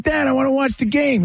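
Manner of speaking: high-pitched imitation of a child; pleading kid. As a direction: plead with dad like a little boy. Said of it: "I'm like".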